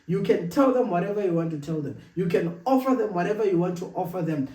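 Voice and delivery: usual speaking voice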